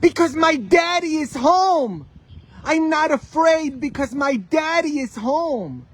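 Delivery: put-on high, loud six-year-old proclaiming; little boy. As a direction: call it out like a confident small boy. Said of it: "he's about six years old".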